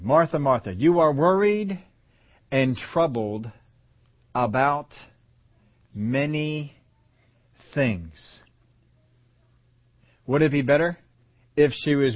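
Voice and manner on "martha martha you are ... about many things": slow, drawn-out tender words; slow gentle rebuke. Acted. Jesus gently correcting Martha, very slow. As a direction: linger on each word gently.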